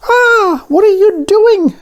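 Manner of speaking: very high, loud alarmed donut cry; donut shouting. A narrator's reading. Arnie about to be eaten, loud high shout. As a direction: yell it in panic. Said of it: "shouted Arnie".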